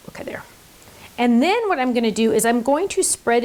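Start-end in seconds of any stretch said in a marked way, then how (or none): 0.1-0.4 quiet whispered aside. Real, not acted. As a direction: whisper it to yourself. Whispered aside while crafting.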